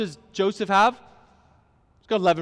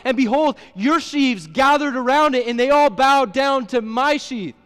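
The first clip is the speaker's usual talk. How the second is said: slow, slightly raised reading of the text; scripture reading; 3 semitones higher, 4 dB louder than usual. Acted. Pastor reading Joseph's dream to the congregation, slow and declaiming. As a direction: read the dream aloud with weight.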